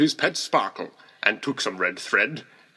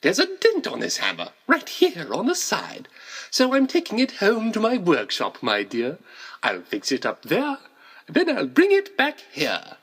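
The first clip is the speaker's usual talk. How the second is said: a much higher, syrupy false-kind Santa voice; fake sweet Santa; 8 semitones higher than usual. A narrator's reading. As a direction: coo the lie sweetly to the child. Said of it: "the fake Santa Claus lied".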